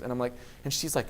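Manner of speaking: ordinary talk, nothing marked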